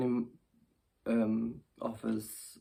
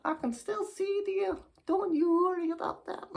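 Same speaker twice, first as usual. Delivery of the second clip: put-on very high, insistent old dear; unbothered old lady; 5 dB louder than usual. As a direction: brush off the fuss as an old lady. Old lady reassures about glasses.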